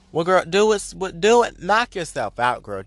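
sassy dare. Real, not acted. Mock daring the offended listener.

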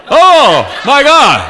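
loud, high comic outburst; shocked newlywed, hammed up; mock horrified shock. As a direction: cry out in exaggerated dismay.

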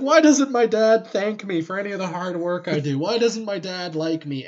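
put-on a pouting kid wailing about dad; mock whiny lament. Lorgar voiced sulking to himself.

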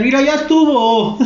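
high-pitched mock outburst; put-on yell, pitched well up. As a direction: imitate a caller losing his patience. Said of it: "No te empezó a gritar".

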